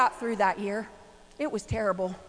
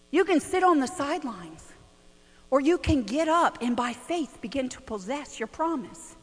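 usual speaking voice

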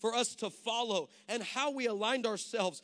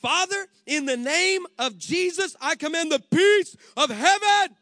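loud prayer; 6 semitones higher, 12 dB louder than usual. Retold or imitated. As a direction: pray with forceful authority. Daughter praying on TV.

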